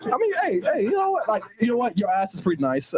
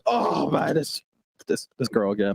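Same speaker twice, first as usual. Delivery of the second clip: weary groan; 8 semitones lower than usual. Retold or imitated. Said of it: "I'm like".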